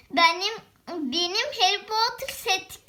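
normal talking voice

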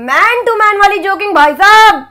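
loud, high, hammed-up line; loud character delivery. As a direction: belt out the joke line.

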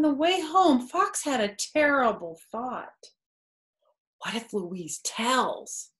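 lively storybook voice with character quotes; reading to children. A narrator's reading. Narrator reading a picture book to kids, lively storytelling. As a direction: read it aloud to a child, bright and animated.

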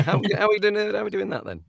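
high-pitched, puzzled mock query; hammed-up helpless confusion, pitched well up; mock bafflement. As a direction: play baffled by an impossible name. Acting narrator baffled by odd spelling.

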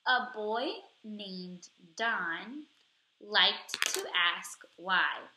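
put-on slow, clear read-aloud for children; teacher reading to class. Shown as a demonstration. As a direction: read each word slowly so they can follow along. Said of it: "reading with me".

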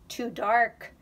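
usual speaking voice

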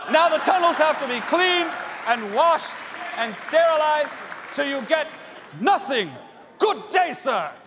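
high, loud, manic Wonka outburst; crazy Willy Wonka. Retold or imitated. Willy Wonka turning on the grandpa, in a crazy loud rant. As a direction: snap it out like an unhinged showman. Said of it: "goes into this crazy thing".

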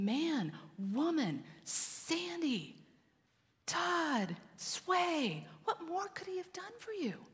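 high, softened, imploring father; acted heartbreak, pitched high and held back in volume; pained frustration. Father God calling his children by name, pained pleading. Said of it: "in pain and frustration".

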